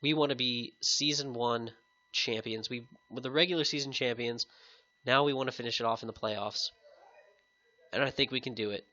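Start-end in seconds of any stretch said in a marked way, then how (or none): none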